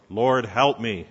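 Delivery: loud desperate plea; mother crying out to Jesus. A narrator's reading. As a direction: beg with all you have. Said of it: "the woman cries out once more".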